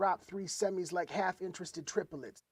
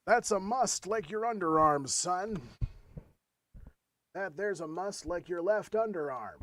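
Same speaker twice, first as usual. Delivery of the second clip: cowboy drawl; 4 semitones higher, 4 dB louder, slower than usual. Pretend. Drawling goofy cowboy riff.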